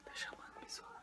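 hushed whisper while the call rings. Real, not acted. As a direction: whisper so she won't hear. Quiet narration while phone rings.

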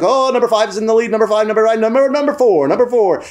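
high-pitched horse race commentary; race calling. Retold or imitated. Man calling out the leaders, high race-caller voice. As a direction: call the runners on an urgent high note. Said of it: "the man was calling out".

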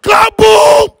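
high, loud furious kaboom; mad shout. Shown as a demonstration. Host shows fan a mad kaboom, loud shout. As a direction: shout it like you haven't eaten. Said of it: "I need you to be mad".

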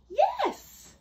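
a very high, loud shout of yes; cried yes. Acted. Witch welcoming the bird aboard, shouting. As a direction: fling out the yes with delight. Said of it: "cried the witch".

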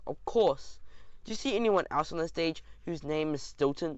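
plain reading voice

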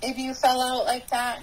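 high, breathless retelling of a frantic friend; mock frightened woman, high and rushed; panicked friend. Friend's panicked words retold.

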